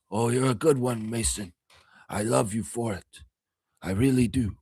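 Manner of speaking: a hint of the Don praising his man; Godfather impersonation. The Don thanking Mason, a light Godfather voice. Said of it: "my Godfather impersonation".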